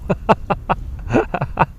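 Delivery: high, soft wheezing laughter; helpless laugh. Real, not acted. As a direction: laugh till the voice gives out. Laughs at Liverpool comment.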